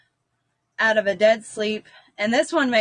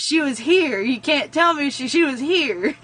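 put-on denying child; 4 semitones higher than usual. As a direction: refuse it like a stubborn kid.

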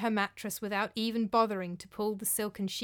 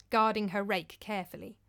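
ordinary delivery

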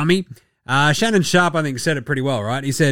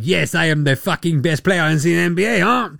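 put-on boastful caricature French accent; mock French accent; 2 semitones higher than usual. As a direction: brag in an exaggerated French accent. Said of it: "all accents and vocalizations approximate".

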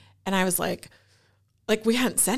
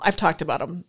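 ordinary talk, nothing marked

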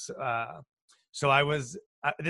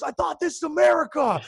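very high, loud cartoonish outrage; imitated screaming; 8 dB louder than usual. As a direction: scream it in mock indignation, voice way up. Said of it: "He's yelling and screaming".

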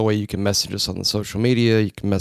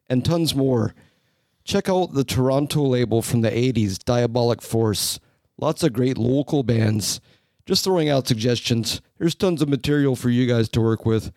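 lilting put-on Canadian accent, pitched up. Host reading a listener's list of bands, put-on Canadian accent. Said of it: "read this message in the accent that I think C J".

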